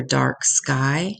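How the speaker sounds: usual speaking voice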